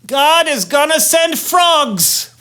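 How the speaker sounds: slow, high pronouncement; solemn warning. Acted. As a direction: announce the doom slowly. Moses announcing plague.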